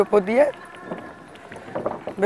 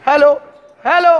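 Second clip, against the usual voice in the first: cathedral echo. Shown as a demonstration. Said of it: "এই পুরো ইকো হচ্ছে".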